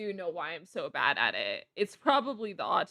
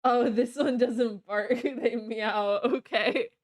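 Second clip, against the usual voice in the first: laughing; 7 dB louder, slower than usual. Real, not acted.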